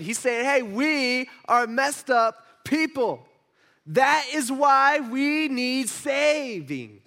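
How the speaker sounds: high, loud, emphatic sermon voice; forceful preaching. Real, not acted. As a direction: drive the point home to the congregation.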